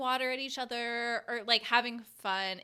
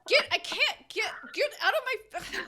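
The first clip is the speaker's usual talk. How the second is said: mock frantic plea; 11 semitones higher, 6 dB louder than usual. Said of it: "And he's like".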